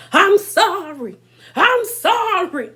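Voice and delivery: high, slow, theatrical sobbing apology; hammed-up pleading; dramatic apology. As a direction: play it like collapsing on the floor. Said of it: "Melissa's dramatic self on the floor".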